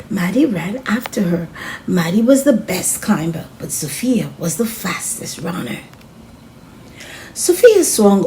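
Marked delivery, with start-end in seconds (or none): none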